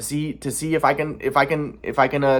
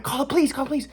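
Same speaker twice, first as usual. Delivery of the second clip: mock panic; 11 semitones higher, 4 dB quieter, faster than usual. Family panicking at his knock.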